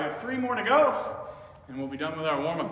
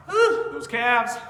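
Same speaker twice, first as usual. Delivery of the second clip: excited whoop; 5 semitones higher, 9 dB louder, much slower than usual. Real, not acted. Coach feeling the calves burn.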